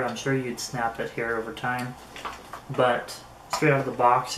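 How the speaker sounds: ordinary talk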